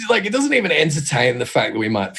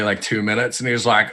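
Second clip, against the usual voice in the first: ordinary talk, nothing marked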